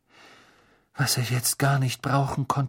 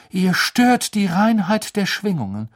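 Trelawney's reproach; 8 semitones higher, 6 dB louder than usual. Acted. Trelawney rebukes the pair.

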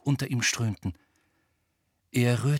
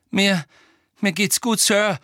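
high, loud stammer; 9 semitones higher, 6 dB louder than usual. A narrator's reading. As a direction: stumble over the first word, pitched up and insistent. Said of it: "stammelte Harry".

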